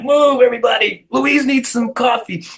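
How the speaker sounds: high-pitched mock announcement to the crowd; mock calling out. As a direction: clear the way for Louise, hammed up. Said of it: "And I'm like".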